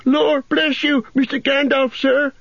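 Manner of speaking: put-on a flustered young gardener caught out, pitched high; Sam, flustered. A narrator's reading. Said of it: "said Sam".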